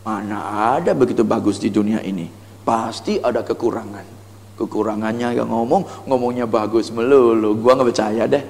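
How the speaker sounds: low, softened scepticism; inner doubt. Acted. Doubting customer thinking to themselves about a pitch, sceptical. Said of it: "Di dalam hatinya".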